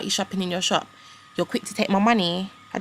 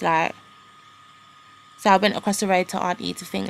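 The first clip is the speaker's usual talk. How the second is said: usual speaking voice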